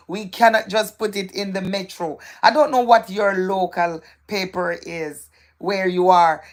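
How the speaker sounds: ordinary delivery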